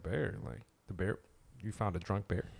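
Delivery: ordinary delivery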